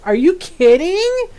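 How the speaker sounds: stunned, drawn-out question; shocked disbelief. Retold or imitated. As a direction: gasp it out in disbelief. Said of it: "Shai's jaw drops open and she goes".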